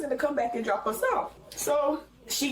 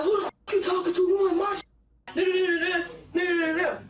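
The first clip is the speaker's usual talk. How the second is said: retold furious yell; 7 semitones higher than usual. Mother screaming in retold fight.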